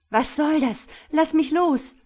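high, loud cry to be released; frightened, indignant yell; alarmed protest. A narrator's reading. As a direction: shout it, fighting off a grip. Frightened woman resisting her captor.

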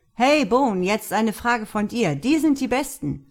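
loud, high call across the room. Acted. Luke calls across the room to Mark, loud and high. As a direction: shout it over to Bone. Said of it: "rief Luke zu Mark hinüber".

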